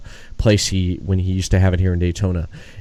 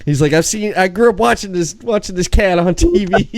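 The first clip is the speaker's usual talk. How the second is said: excited fan; 10 semitones higher than usual. Retold or imitated. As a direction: gush like a starstruck kid. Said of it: "he was so excited about it".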